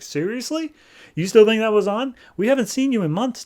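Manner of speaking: high, incredulous scolding; mock her disbelief; scornful daughter.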